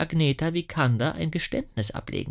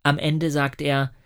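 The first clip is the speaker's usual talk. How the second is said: usual speaking voice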